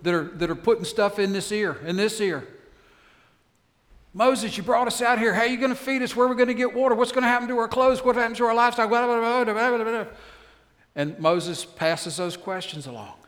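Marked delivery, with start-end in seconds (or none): 4.1-10.1 high, loud, nagging grumbles fired one after another; pestering people, raised and insistent; complaining crowd. Acted. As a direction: play the whiny crowd badgering Moses with questions. Israelites complaining to Moses.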